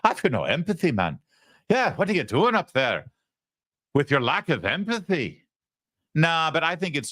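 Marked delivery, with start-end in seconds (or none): 0.0-5.4 higher, lilting mock Irish leprechaun; mock Irish accent. Host mocking a politician's accent with Lucky Charms, mock Irish. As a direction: put on a swinging cartoon Irish accent. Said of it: "kind of an Irish Jamaican".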